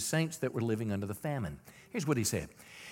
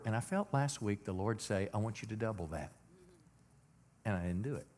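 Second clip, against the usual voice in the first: hushed; 4 semitones lower, 7 dB quieter than usual. Real, not acted. Recalling God's soft request.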